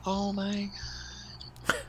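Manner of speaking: high, strained mock panic; panicked. Guest running back from the boat, panicked high voice. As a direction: gasp it out like you just saw a death.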